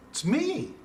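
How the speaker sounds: "me" leaping very high, then dropping; emphatic, high-pitched "me"; strong stress on ME. Shown as a demonstration. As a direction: punch "me" way up in pitch. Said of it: "It's emphasis".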